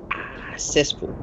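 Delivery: breathy whisper, drawn out; whispered savoring. Real, not acted. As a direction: breathe the name slowly. Savoring the name.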